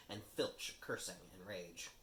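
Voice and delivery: ordinary delivery, nothing marked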